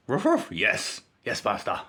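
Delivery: lightly voiced obedient dog, bark then a meek yes master; a hint of a dutiful dog answering its owner; playful dog bark reply. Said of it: "the dog goes".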